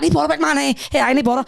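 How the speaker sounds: hammed-up hard-man hello; mock tough greeting. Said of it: "I'm like".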